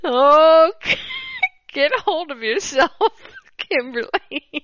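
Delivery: scolding oneself through real giggles; fighting laughter. Real, not acted. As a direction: try to pull yourself together while laughing. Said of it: "get through this without laughing".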